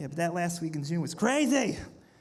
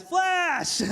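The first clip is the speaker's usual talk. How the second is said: bar greeting shout; 9 semitones higher than usual. Retold or imitated. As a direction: hail a regular at the bar.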